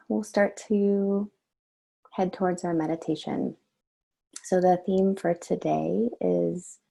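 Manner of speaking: normal talking voice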